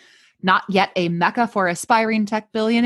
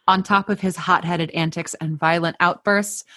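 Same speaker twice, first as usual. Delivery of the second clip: ordinary talk, nothing marked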